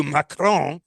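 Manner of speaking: showy mock French pronunciation; exaggerated French accent. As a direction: throw on an overdone French accent. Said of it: "they break into that accent".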